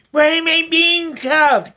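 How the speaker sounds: put-on high, loud, slow pleading child voice; mock child's voice. Vlogger voicing the boy in the clip, high loud slow whine. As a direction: squeak it out as a whiny kid.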